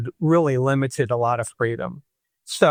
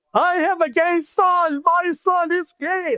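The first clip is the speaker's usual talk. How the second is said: much higher, slow, hammed-up hysterical mother; mother announcing to the whole neighborhood, high and slow like a drama queen; slower than usual. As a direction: wail it out like a drama queen having a breakdown. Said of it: "like, having, like, a nervous breakdown, like a drama queen".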